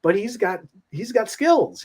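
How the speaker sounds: high, excited amazement; amazed. Retold or imitated. Fan up front at a show, awestruck and high. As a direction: gush in awe, voice jumping up high. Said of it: "I'm like".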